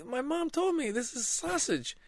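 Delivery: put-on very high, slow child voice; squeaky child insisting; upset kid. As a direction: defend yourself like a third grader. Child defends himself.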